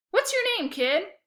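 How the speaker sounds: a touch of a breezy hustler; loud, high and cocky; salesman kid. A narrator's reading. Otto greets a young customer, breezy and cocky.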